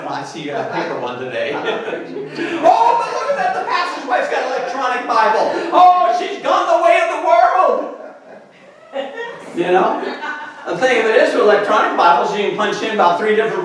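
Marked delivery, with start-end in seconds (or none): 1.5-8.0 mock judgmental gasp. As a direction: play the shocked church gossip. Mocking judgmental churchgoers.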